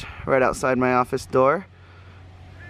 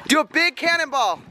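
cannonball yell; 10 semitones higher than usual. Real, not acted. Urging a cannonball.

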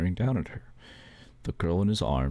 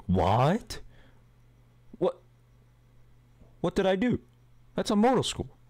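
shocked protest; 12 semitones higher than usual. Acted. Daughter protesting being sent to a mortal school, shocked and high. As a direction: squeal it like the unfairness stings.

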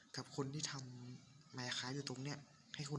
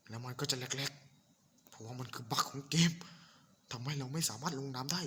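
frustrated; 7 dB louder than usual. Real, not acted. Frustrated at game bug.

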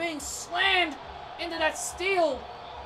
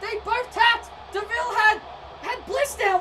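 ordinary talk

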